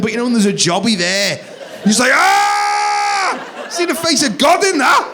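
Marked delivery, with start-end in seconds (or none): 2.1-3.7 very high, drawn-out comic scream; horrified scream. Acted. Dad finding a jobby in the pants, mock-horrified scream. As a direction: shriek in mock horror.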